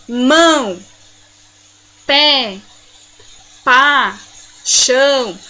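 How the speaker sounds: each word alone, loud, with pauses; loud, separate single beats; one-syllable demo. Shown as a demonstration. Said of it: "As palavras que têm uma sílaba".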